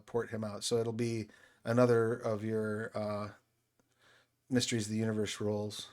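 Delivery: normal talking voice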